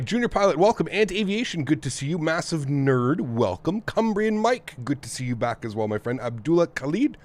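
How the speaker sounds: usual speaking voice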